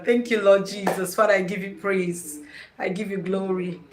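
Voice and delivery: soft, low praise to God; quiet prayer. Real, not acted. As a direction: murmur a thankful prayer. Spontaneous prayer on live.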